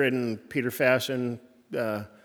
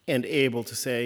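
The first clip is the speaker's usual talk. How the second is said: usual speaking voice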